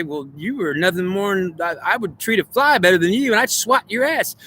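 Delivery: contempt rising to a high-pitched finish; mock scornful. Goddess telling God a fly is better, mock scorn. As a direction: act out an indignant put-down, pitch climbing.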